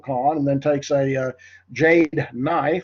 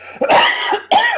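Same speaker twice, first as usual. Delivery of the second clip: real loud coughing fit; choking cough; 6 dB louder than usual. Real, not acted. Lecturer choking on tea mid-class, coughing hard. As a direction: cough hard on a drink gone down the wrong way. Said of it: "Got choked up on my tea".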